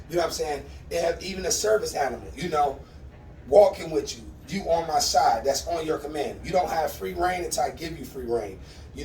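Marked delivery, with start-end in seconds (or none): none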